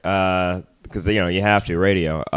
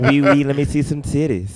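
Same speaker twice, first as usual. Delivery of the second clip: mock French accent; 5 semitones higher, 3 dB louder than usual. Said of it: "French [name]".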